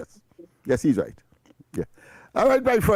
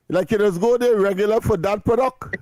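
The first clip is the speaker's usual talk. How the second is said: laughing; 3 semitones higher than usual. Real, not acted. Host breaks into laughter.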